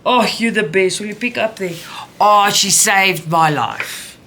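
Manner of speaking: high, loud relieved praise; mother loudly thanking daughter for finding scissors; relieved delight. Real, not acted. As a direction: gush with relief at the found scissors.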